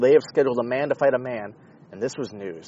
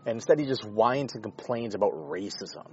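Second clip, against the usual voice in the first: usual speaking voice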